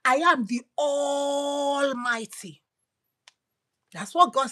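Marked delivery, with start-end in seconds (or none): 0.1-2.6 slow proclamation. Acted. God proclaims, slowed.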